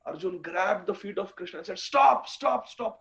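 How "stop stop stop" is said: urgent plea. Acted. Arjun begs Krishna to stop.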